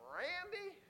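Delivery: high, drawn-out call of the name; calling a friend. Retold or imitated. Preacher breaking in on his friend, high questioning call. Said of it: "And I said".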